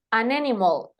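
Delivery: "an animal" linked as a-nanimal; todo junto. Shown as a demonstration. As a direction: carry the n of "an" straight into "animal". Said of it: "suena todo junto". ("an animal" todo junto, sin pausa)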